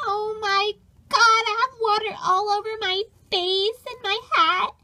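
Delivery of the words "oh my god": normal talking voice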